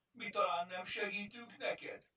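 small creature. A narrator's reading. Kajtánka's hurt complaint.

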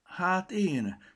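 the coin answers timidly. A narrator's reading. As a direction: answer shyly, a bit scared. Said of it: "válaszolta valaki megszeppenten".

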